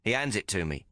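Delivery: usual speaking voice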